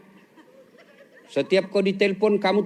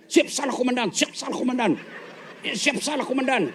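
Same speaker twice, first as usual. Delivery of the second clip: submissive subordinate; 4 semitones higher, 4 dB quieter than usual. Retold or imitated. Policeman scolded by commander.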